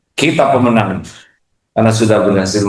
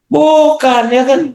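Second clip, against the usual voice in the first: sudden high squeal of no; exaggerated no. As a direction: yelp it out. Exaggerated reaction. (teriakan kaget yang dibuat-buat)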